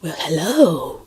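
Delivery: slow, breathy, drawn-out hello; mock charming clerk hello; character's greeting. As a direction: play the quirky clerk, savouring it. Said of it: "he was a character".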